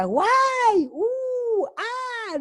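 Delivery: high, swooping oohs and aahs; exaggerated wowing for a small child; playful mock amazement. Grandmother reacting to granddaughter's things, playful mock amazement. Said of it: "saca unas cosas y yo digo".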